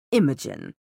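low, unhurried villain greeting; composed villain. Acted. Valentine appearing before the Inquisitor, low and composed.

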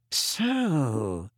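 drawn-out, ominous opening; menacing Snape. A narrator's reading. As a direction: let it hang as a threat. Furious Snape confronts Harry.

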